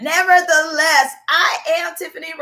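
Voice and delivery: emphatic nevertheless. Real, not acted. People's cheerleader pumping up her audience, loud and emphatic. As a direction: launch it like a cheerleader. Host hyping her own show.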